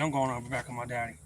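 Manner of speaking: normal talking voice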